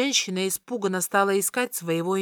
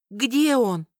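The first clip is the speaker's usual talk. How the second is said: angry; 3 semitones higher, 4 dB louder than usual. A narrator's reading. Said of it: "обнажив клинок, произнесла Катарина".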